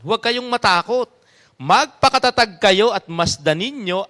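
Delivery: loud, slow proclamation of scripture; Bible reading. Acted. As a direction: declare the verse slowly and firmly. Reads Exodus aloud. (malakas at mabagal na pagbasa ng Kasulatan)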